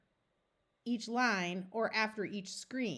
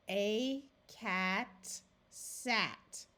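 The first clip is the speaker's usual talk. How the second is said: very slow, word by word; sounded out slowly; much slower than usual. Shown as a demonstration. Teacher reading a sentence for beginners, very slowly. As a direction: stretch out "A cat sat". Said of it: "we sound it out slowly".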